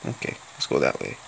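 put-on quick whispered aside in the Russian accent; whispered, sneaking down the street. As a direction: hush it to yourself, fast. Quick muttered aside while playing.

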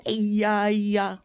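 low crooned pretend comfort; soothing murmur. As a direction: croon low to a crying toy. Soothes crying toy lion.

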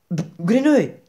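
high, loud answer after a stumble; eager Grenouille. Acted. Grenouille giving Baldini his name, blurting.

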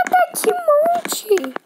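very high, very slow "Tá até de monte"; squeaky drawn-out repeat. Real, not acted. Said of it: "Tá até de monte". ("Tá até de monte" bem agudo e arrastado)

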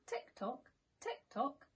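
soft tick-tock; gentle clock ticking. Acted. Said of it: "went the living room clock".

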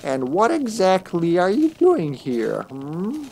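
put-on high, creaky old crone asking; a suspicious hag peering out of the dark. Crone voice from the shadows.

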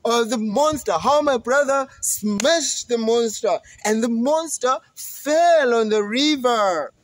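bursting to tell the news; excited goat. Acted. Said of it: "The middle Billy Goat was like".

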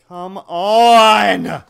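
loud, drawn-out yell; frustrated shout. Real, not acted. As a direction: shout at the screen in real anger. Gamer raging at game.

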